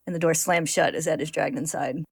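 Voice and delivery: ordinary talk, nothing marked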